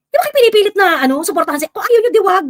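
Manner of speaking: loud, high, fed-up scolding; exasperated outburst. Real, not acted. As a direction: raise the voice at the critics, fed up. Exasperated at angry commenters. (inis na pagsigaw)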